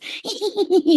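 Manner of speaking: very high, impish little titter; imitated munchkin giggle. Shroom guys making fun of the tripper, high imitated giggle. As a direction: giggle like tiny teasing creatures. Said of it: "they were laughing like that".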